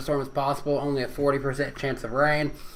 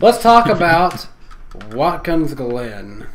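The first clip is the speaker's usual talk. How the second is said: announcer voice; 6 dB louder, slower than usual. Acted. Announcing the next topic.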